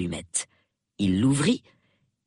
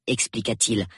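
plain reading voice

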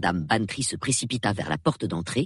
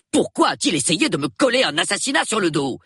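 fast, higher-pitched indignant protest; indignant outburst; 7 semitones higher, 4 dB louder, faster than usual. A narrator's reading. Colonel protests being framed for murder, exploding with indignation. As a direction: fire the question out, aggrieved and emphatic. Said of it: "explosa-t-il soudain dans un regain d'indignation".

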